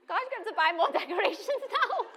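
high, loud, laughing mock despair; laughing exasperation. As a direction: sigh to God through laughter.

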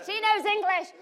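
high, loud announcement; eager exclaim. Retold or imitated. Pastor pushing her forward.

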